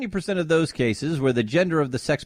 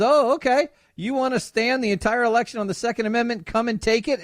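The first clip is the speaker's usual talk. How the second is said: higher, rally-speech Cruz; Ted Cruz impression; 7 semitones higher than usual. Cruz answering the heckler at his rally, lightly imitated. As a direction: rouse the crowd like a candidate. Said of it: "and he says".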